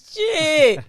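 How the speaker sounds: loud, high exclamation; a sudden loud burst of surprise; startled exclamation. Retold or imitated. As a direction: throw it out like a shocked gasp of a word. Retelling her own reaction to 800 people.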